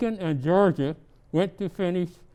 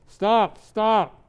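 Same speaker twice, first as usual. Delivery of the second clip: yelling; 5 semitones higher, 5 dB louder than usual. Acted. Tree racket recap.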